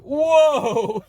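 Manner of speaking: high, loud drawn-out whoa; stunned whoa. Retold or imitated. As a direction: gasp it out like you just saw a space cowboy. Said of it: "he's like".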